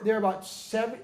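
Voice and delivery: usual speaking voice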